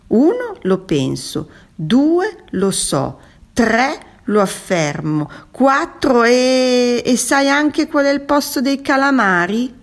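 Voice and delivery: counting builds to high, loud peaks; mounting challenge. A narrator's reading. Tuna closing in on the squid, challenging.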